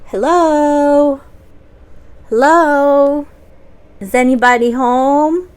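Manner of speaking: loud, high call into an empty castle. Acted. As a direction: shout hello up at the door. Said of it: "So he yells out".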